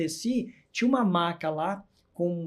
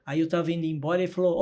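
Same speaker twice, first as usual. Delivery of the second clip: ordinary talk, nothing marked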